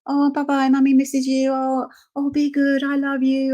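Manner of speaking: put-on high, gushing goodbye baby-talk; doting owner farewell. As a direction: fuss over the dog on the way out. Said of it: "We're not gonna go out and go".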